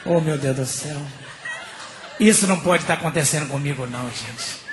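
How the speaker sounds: low, subdued lament; comic despair. Retold or imitated. Blind man laments the mix-up, low despair. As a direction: mutter it low, at the end of your patience.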